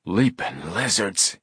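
drawn-out slow exclamation of surprise; understated, slow astonishment; startled exclamation. A narrator's reading. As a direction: stretch out each word in amazement. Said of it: "he exclaimed".

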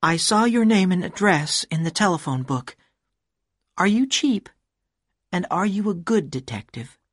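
high, curt woman's voice; rude woman's voice. A narrator's reading. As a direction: talk down to him. Woman character voice.

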